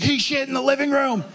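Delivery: very high imitated frantic mom. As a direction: squeal it like a panicked mother on the phone.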